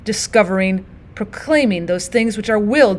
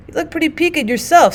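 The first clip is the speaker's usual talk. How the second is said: tattered soldier voice; 7 semitones higher than usual. Acted.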